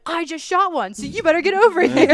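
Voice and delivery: high, loud, urgent retelling; freaking out. Retold or imitated. Hunter phoning dad after shooting a deer, freaking out. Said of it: "I'm, like, freaking out".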